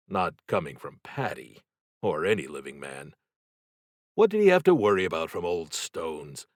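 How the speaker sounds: ordinary delivery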